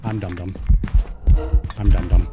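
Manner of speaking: repeated panicked low cries. Real, not acted. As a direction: cry it out while fleeing. Chased by the ghost.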